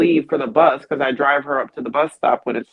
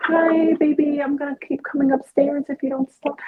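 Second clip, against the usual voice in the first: sweet threat; 11 semitones higher, 3 dB quieter than usual. Pretend.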